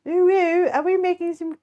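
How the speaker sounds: high, cutesy, badly faked French accent; attempted French accent. Streamer trying the uwu cake line again, badly faked French accent. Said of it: "What if I did in a French accent?".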